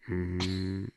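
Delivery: soft, low electric-car hum; quiet car hum. Retold or imitated. Co-host imitating a Prius driving away, quiet low hum. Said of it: "it'd be a really quiet".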